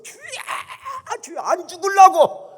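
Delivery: strained pleading shout into the night; crying out to God. Retold or imitated. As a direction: wail to the Lord on a freezing mountain. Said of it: "부르짖는데".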